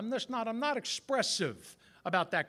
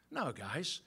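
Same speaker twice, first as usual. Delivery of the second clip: usual speaking voice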